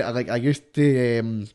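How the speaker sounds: normal talking voice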